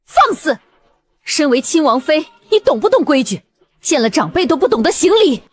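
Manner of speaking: high-pitched imperious scolding; indignant rebuke. Acted. Elder scolds princess consort over manners, indignant. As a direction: put the young wife in her place.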